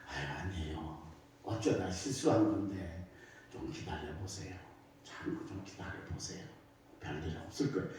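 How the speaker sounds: low, hushed, soothing reassurance; soothing calm. Retold or imitated. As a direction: gently talk an angry mother down. Pastor calms the furious mother. (화난 어머니를 달래는 목사의 낮고 조용한 목소리)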